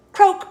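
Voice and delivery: high, loud croak; toad croak. A narrator's reading. Toad saying good night, loud croak.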